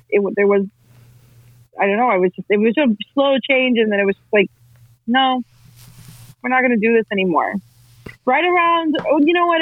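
normal talking voice

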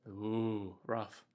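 soft low wincing ooh; quiet wince. Real, not acted. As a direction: wince at the grim news. Wincing reaction to gore.

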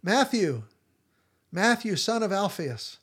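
loud call across the street. Retold or imitated. Jesus calling to the tax collector from the street, loud call. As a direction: call his name out loud to get his attention. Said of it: "And he calls out".

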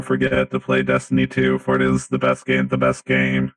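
deep, monotone robot voice. Acted. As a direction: stiff robot declaring a favorite game. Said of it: "thank you, Nickotron three thousand".

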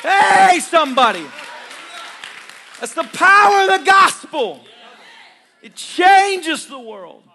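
loud, slow, high preaching; full-force sermon cry. Acted. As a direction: drive each line home to the church. Preaching climax continues.

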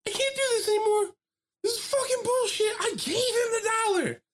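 very high, strained imitated sobbing rant; crying upset. Upset worker crying on the phone to her manager, high and strained. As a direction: mimic a worker breaking down in tears. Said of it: "She's crying".

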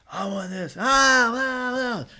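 put-on high, loud shrieking kid; child tantrum. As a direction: wail like a toddler throwing a fit, very high. Imitating middle child tantrum.

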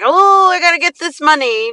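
high, anxious money-grab voice; mock desperation for cash; hammed-up needy seller; desperate grabbing. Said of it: "not at all like".

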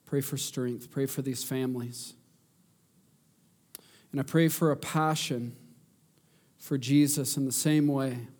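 earnest pleading prayer. Real, not acted.

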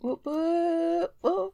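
high excited whoops; cheering. Real, not acted. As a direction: whoop it up like a party. Cheering a birthday.

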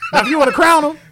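mock press-conference meltdown; imitating Dennis Green. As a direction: yell it like a furious coach. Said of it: "best soundbite".

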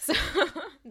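laughing through words; giggling as she speaks. Real, not acted.

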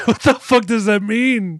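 high, loud, slow disbelieving cry through a laugh; incredulous laughing outburst. Real, not acted. Host reacts to the dumb movie insult, incredulous and laughing. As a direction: blurt it out, laughing at how dumb it is.

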